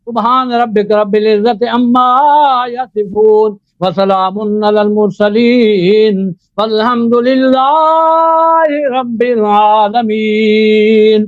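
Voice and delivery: slow, measured, chanted khutba recitation; formal Arabic sermon opening. Acted. Debater opens his turn with a slow chanted khutba. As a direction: intone the opening praise slowly like a preacher.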